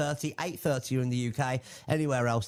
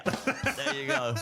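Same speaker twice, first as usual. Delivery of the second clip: laughing; 12 semitones higher, much slower than usual. Real, not acted. Laughing at the joke.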